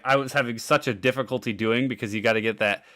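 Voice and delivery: ordinary talk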